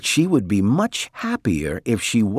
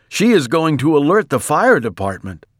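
higher, lightly acted proclamation; lightly voiced excited husband; 6 semitones higher, 4 dB louder than usual. A narrator's reading. Mister Watson sure of rescue, mildly excited announcing.

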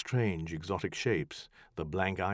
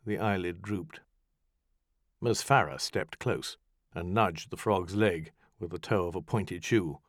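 ordinary delivery, nothing marked

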